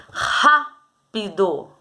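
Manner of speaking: "Rápido" drawn out very slowly, syllable by syllable; slow sounding out. Shown as a demonstration. Teacher sounding out "Rápido" for pupils, very slow. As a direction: stretch "Rápido" to find the strong syllable. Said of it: "falar com calma pra você descobrir".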